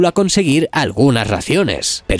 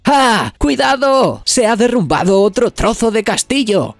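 grito agudo y alarmado, mucho más alto; 7 semitones higher than usual. A narrator's reading. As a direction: Nami avisa a gritos del peligro. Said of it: "le grita". (Nami grita a los demás, alarmada y aguda)

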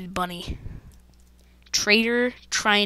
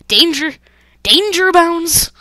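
loud, high warning shout; alarmed cry; 7 semitones higher, 9 dB louder, slower than usual. Real, not acted. Gamer warning of danger, alarmed and loud. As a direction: call it out high and drawn-out.

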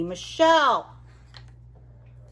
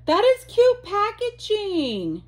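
much higher and louder with a fall on is; delighted squeal; 12 semitones higher, 6 dB louder than usual. Real, not acted. Admiring Villains packaging for viewers, high delighted gush. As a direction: gush over it.

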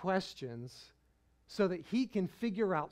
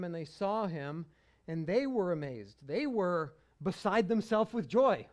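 ordinary talk, nothing marked

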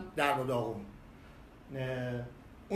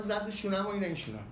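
ordinary delivery

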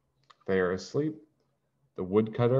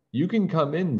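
witch voice; 8 semitones higher than usual. Acted. Witch invites children.

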